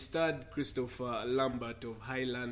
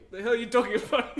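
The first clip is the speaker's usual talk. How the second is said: laughing mock retort; 7 semitones higher, 6 dB louder, faster than usual. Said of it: "everyone is like".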